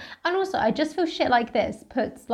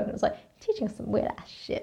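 whispered aside; 10 dB quieter, faster than usual. Real, not acted. Muttered aside about the book.

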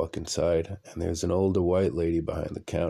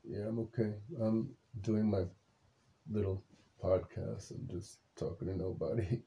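much softer, half-voiced late-night murmur; hushed; 2 semitones higher, 10 dB quieter than usual. Real, not acted. Telling his just-woken wife he's only podcasting, hushed. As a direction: keep it down so nobody wakes.

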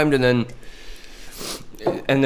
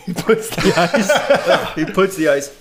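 laughing through the words; giggling while trying to talk; 7 semitones higher, 3 dB louder, much slower than usual. Real, not acted. Cracking up mid-pitch.

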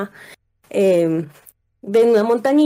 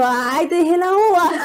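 nervous laugh; 9 semitones higher than usual. Retold or imitated. Startled friends laugh nervously.

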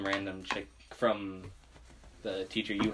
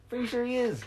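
much higher mock show-host voice; 13 semitones higher than usual. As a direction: tease like a game show host.

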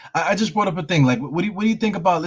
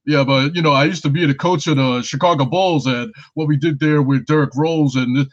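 Tom Thibodeau impression; 5 dB louder than usual. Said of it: "my Tom Thibodeau impersonation".

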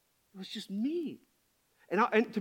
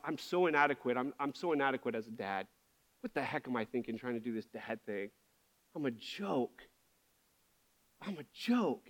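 subdued, low self-criticism; understated shame; ashamed self-talk; 6 semitones lower, 6 dB quieter than usual. Retold or imitated. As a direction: act out your own discouraged thoughts, softening as you go. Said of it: "I can stay in shame".

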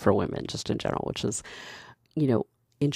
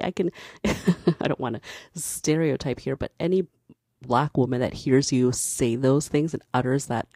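usual speaking voice

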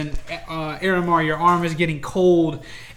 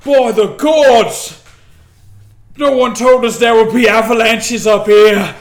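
higher, much louder outraged orc bellow; indignant orc shout; 6 semitones higher, 12 dB louder than usual. A narrator's reading. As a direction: roar it out, offended by the avalanche. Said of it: "brush themselves off and say".

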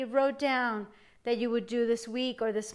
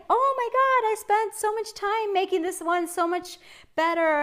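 high, loud mock outburst; exasperated disbelief; 8 semitones higher, 4 dB louder than usual. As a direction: exclaim it in exaggerated dismay. Retells frustration at a viral blooper.

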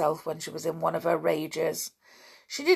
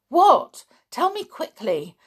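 high, excited plea; eager begging; 9 semitones higher, 8 dB louder than usual. A narrator's reading. Anne hears of thrilling news, begging eagerly. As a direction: beg to hear the news at once. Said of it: "begged Anne".

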